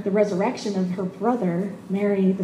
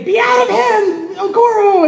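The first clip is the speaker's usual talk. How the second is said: hammed-up preacher's command, much higher and louder, slow; mock exorcism command; 10 semitones higher, 9 dB louder, slower than usual. Preacher pretends to cast a demon out of Okoro, loud mock command. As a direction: play-act casting out a demon.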